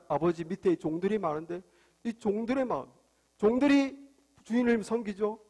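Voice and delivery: usual speaking voice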